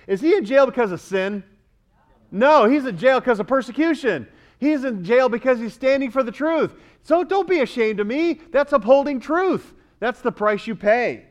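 high, forceful sermon delivery; impassioned preaching. Real, not acted. Preacher defending Paul jailed for the truth, impassioned. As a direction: press the point with conviction.

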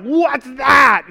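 loud, high mock alarm; retold startled shout. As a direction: cry out as if startled by a huge noise. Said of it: "We're like".

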